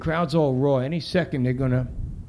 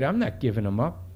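plain reading voice

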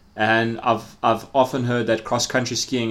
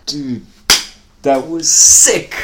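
frat bro; 3 semitones higher, 10 dB louder, much slower than usual. Real, not acted. Hyped after recording ends.

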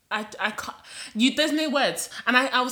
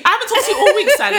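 laughing mock snub; 10 semitones higher, 5 dB louder, much faster than usual. Continues acted snub.

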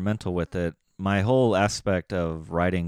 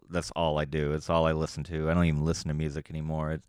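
ordinary delivery

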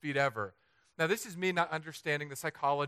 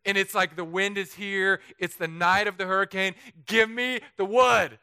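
louder, building to a slow loud demand; pleading demand; 4 semitones higher, 6 dB louder than usual. Retold or imitated. Demanding plywood from the neighbor, building to loud and slow. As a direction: beg for the wood, louder and louder. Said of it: "I was like".

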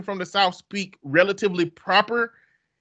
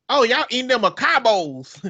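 higher-pitched mock Southern country voice; country Southern accent; 8 semitones higher than usual. Guest at the family's house, country Southern accent. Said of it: "might as well sound like old country redneck".

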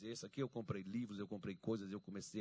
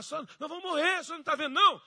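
high, loud mock alarm; panicked yelling; 15 dB louder than usual. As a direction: shout frantically to wake the sleeping master. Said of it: "eles estavam lá gritando".

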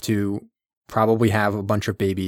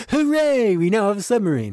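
mock cheering; 10 semitones higher than usual. Council welcomes the healthy submarine, high mock cheer. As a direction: celebrate in a high, silly cheer.

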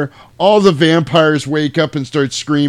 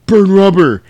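mock command; 6 semitones higher, 7 dB louder than usual. Corey Feldman orders the getaway.

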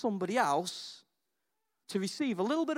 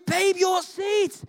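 mock excited call; 11 semitones higher, 5 dB louder, much slower than usual. Calling to wife mid-surgery.

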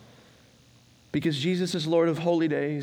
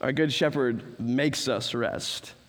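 slow, fading; 3 semitones lower, 3 dB quieter, slower than usual. Acted. Preacher slows down on rest.

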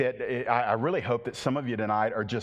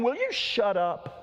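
slow, scornful shut up; annoyed crowd; 9 semitones higher than usual. Acted. The crowd snapping at the beggar, slow and scornful. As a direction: talk down at him, fed up. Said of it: "And the people are saying".

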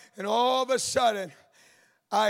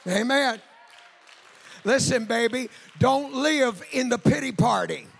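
shouted, higher sermon cry over the organ; full force preaching; 6 semitones higher, 5 dB louder than usual. Acted. Preacher urging the congregation, full force preaching. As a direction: preach it out loud, rising to a call.

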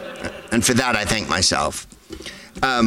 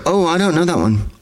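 high feigned ignorance; mock audience voice; 6 semitones higher than usual. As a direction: play dumb, higher pitch. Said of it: "taking the stance of".

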